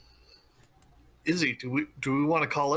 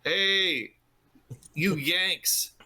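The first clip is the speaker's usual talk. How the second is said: calling out; 5 semitones higher, slower than usual. Pretend. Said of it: "and then yell".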